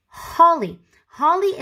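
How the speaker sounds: holly called high and loud; model word call-out. Shown as a demonstration. Teacher names holly picture for children, bright call-out. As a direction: sing out holly for the class. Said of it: "Let's say them together".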